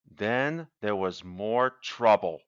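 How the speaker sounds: ordinary delivery, nothing marked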